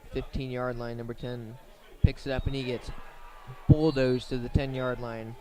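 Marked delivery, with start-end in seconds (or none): 2.0-5.4 excited call. Real, not acted. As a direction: voice jumps louder as the returner gets flattened. Big hit on kickoff return.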